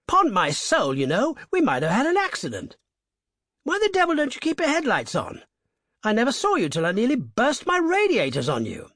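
aggrieved toff. A narrator's reading. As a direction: bluster at the reckless driver. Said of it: "cried an aggrieved voice".

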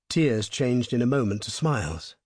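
usual speaking voice

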